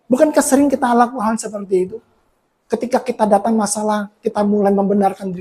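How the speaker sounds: usual speaking voice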